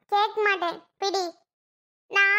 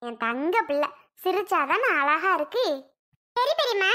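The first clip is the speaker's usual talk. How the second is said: normal talking voice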